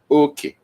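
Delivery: Okay with the first syllable high and the second low; high-low tones. Shown as a demonstration. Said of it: "Okay means share".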